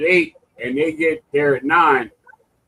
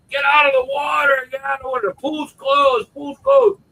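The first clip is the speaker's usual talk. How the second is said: lifeguard shouting warnings; 9 semitones higher than usual. Retold or imitated. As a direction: yell it from the pool edge. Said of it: "the lifeguards are like".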